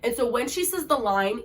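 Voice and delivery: usual speaking voice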